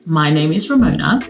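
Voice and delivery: ordinary talk, nothing marked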